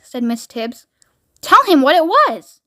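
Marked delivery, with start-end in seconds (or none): 1.4-2.6 raised, emphatic order; sharp scolding. Acted. Miss Tibbs scolding the chief interpreter, exasperated command. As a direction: demand an answer as if fed up.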